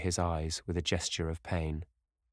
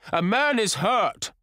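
high, loud, slow shout; top of his voice; 7 dB louder, slower than usual. A narrator's reading. Sir Geoffrey alerting the shooters to an injured man, full shout. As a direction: holler it slowly for all to hear. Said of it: "at the top of his voice".

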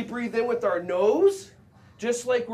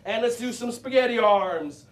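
usual speaking voice

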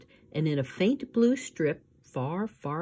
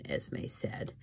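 ordinary delivery, nothing marked

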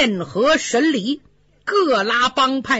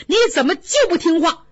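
ordinary delivery, nothing marked

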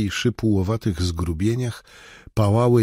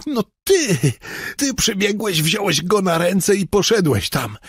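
much higher, shaken voice; breathless, high-pitched confession; frightened man recounting; 11 semitones higher than usual. Acted. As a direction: tell it in a rising panic. Robert insists.

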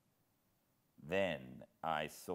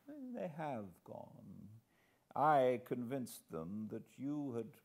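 put-on high, silky old man, pitched up, dropping to a murmur on the name; sly old master; 3 semitones higher than usual. Old host to trapped Hannay, silky old-villain purr. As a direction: purr it like a polite old villain savouring his catch. Old spy chief addresses the hero.

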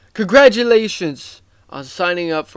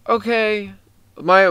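normal talking voice

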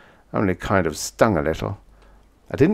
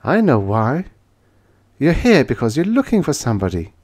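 triumphant; 6 semitones higher than usual. A narrator's reading. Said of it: "she said triumphantly".

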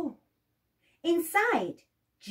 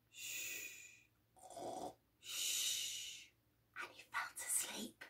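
hushing whisper; 18 dB quieter than usual. A narrator's reading. Hushing as giant sleeps.

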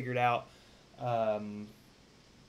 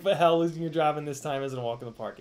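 usual speaking voice

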